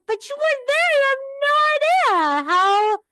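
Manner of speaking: defensive, rising pitch; exasperated pleading. Retold or imitated. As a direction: plead your case, voice jumping up. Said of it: "And I'm like".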